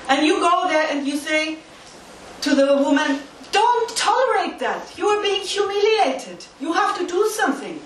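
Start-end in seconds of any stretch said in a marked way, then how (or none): 3.5-7.8 higher-pitched, insistent appeal; acted urging. Social worker to a beaten wife, urgent appeal. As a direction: urge her firmly, pitch lifted.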